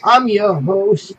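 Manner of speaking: show host. Pretend. Host announcement, loud and slow.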